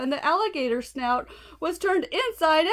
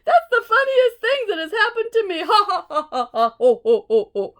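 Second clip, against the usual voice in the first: loud, laughing through the words; 6 semitones higher, 6 dB louder than usual. Acted. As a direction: laugh helplessly while talking. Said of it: "He began to giggle".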